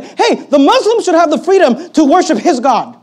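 loud, high mock proclamation; sarcastic high-pitched quote; mock quotation. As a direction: announce it as an absurd decree, high and loud.

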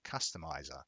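normal talking voice

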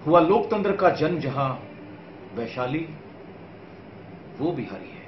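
restrained verse in short, separated phrases; measured poem recitation. Acted. Poet tells viewers of Bihar's heritage, measured recitation.

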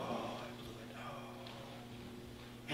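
whispered, deflated self-reproach; discouraged whisper. Retold or imitated. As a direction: sigh it out like you've given up. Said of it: "you're starting to go".

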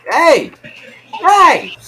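very high, loud yelp; startled shout. Real, not acted. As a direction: shout in sudden protest. Protest when hit in game.

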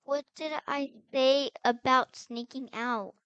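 loud, stern big-sister telling-off; play-acted reprimand; scolding.

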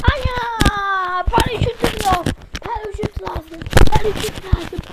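loud, high panicked yell; screaming while falling. Pretend. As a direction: scream for a parachute. Falling without parachute.

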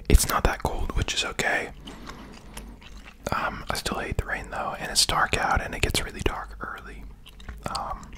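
breathy close-mic whisper throughout. Real, not acted.

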